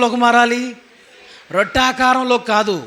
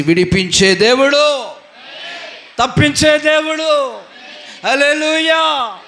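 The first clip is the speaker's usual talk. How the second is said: preaching shout; 4 semitones higher than usual. Acted. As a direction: ring it out to the congregation. Preaching climax.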